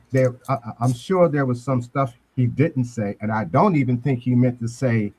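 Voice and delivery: ordinary talk, nothing marked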